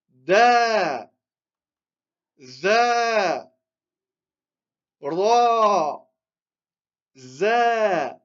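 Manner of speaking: each syllable held long on its "a", as in "Da", "za", "ra"; drawn-out long vowels. Shown as a demonstration. Teacher showing students the lengthened letters, long held vowels in "Da", "za", "ra". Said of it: "ici, c'est seulement on allonge".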